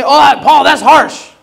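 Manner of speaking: lightly mock a member who finds Paul too harsh; a hint of a whining congregant; mock church complainer. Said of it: "Someone say".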